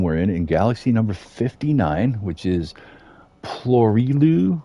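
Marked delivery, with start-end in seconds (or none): 3.4-4.6 slow, higher attempt at "Plorilu"; struggling pronunciation. Real, not acted. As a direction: sound out "Plorilu" slowly, pitched up and louder. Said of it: "It's really hard to pronounce".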